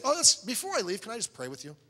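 high opening, dropping to an offhand ask; mock casual pitch. Soul winner slipping in a prayer before leaving, mock casual. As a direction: slip the request in as an afterthought, mockingly. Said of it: "we're not a used car salesman".

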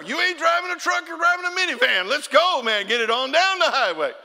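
high, loud exasperated yelling; exasperated shout. Retold or imitated. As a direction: yell at him to speed up. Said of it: "retort to driver".

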